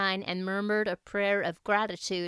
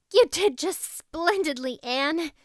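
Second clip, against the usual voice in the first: puffed; 7 semitones higher, 4 dB louder than usual. Acted. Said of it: "puffed Diana".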